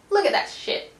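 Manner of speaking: high, loud, thrilled outburst; excited exclamation. Real, not acted. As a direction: gush over the card. Excited at card.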